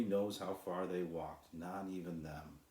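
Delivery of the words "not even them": plain reading voice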